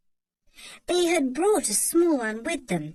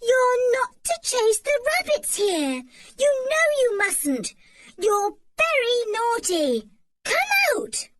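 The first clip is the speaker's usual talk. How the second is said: loud, high telling-off for a dog; scolding shout; 12 semitones higher, 4 dB louder than usual. A narrator's reading. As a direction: scold the naughty dog loudly. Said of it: "shouted George".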